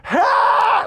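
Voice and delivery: a long high wordless yell. Retold or imitated. Dudley running after the men, yelling a high ah. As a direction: call out ah to get attention. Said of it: "like that".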